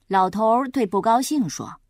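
ordinary delivery, nothing marked

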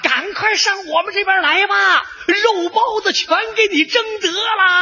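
high, carrying holler; calling out. Acted. Soldier luring a hungry enemy over, calling out. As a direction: call temptingly to the other side.

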